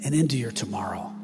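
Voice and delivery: breathy whispered ending. Acted. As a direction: drop to a whisper. Whispered close of question.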